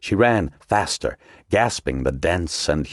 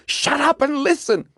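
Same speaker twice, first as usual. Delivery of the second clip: hissed. A narrator's reading. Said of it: "Margot hissed".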